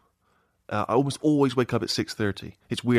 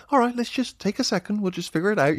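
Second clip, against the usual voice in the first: higher-pitched, patient parent talking someone through it; paternal; 9 semitones higher than usual. Acted. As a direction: play the parent calling for a moment. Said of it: "paternal in the sense".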